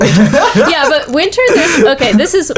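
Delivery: laughing through the words, pitch up; amused, half-laughing reply. Real, not acted. Laughing reaction to "winter.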